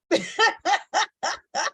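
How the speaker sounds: high, bursting laugh. Real, not acted. Host moved by the poem, laughing off tears. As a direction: laugh it out in a long high run.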